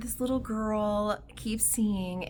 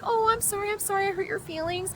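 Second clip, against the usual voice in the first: put-on high, pleading child's voice; apologetic little girl; 13 semitones higher, 6 dB louder, faster than usual. Little girl apologizing to her crying friend, high pleading. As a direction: plead anxiously, high and loud.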